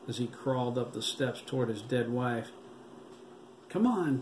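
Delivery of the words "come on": high urgent call, pitched up; boy urging friends to follow the zombies; urgent cry. A narrator's reading. Urgent, pitched-up cry. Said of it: "J T cried".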